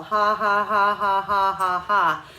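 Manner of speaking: loud, steady mock ha ha ha laugh; connected mock laugh, no breaks; sarcastic laugh. Shown as a demonstration. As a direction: laugh sarcastically but keep blowing. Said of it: "the laughing sarcastically idea works".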